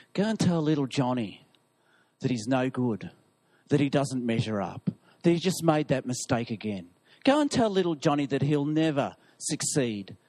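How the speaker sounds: a low conspiratorial devil sending out his minions; scheming devil. Acted. Devil instructs his cohorts to lie to Johnny, low and scheming.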